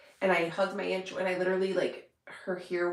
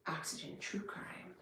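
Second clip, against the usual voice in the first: 'Oxygen True Crime' whispered, much softer, rising at the end; 15 dB quieter than usual. Pretend. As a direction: breathe 'Oxygen True Crime' like a secret answer. Answering her own imagined question.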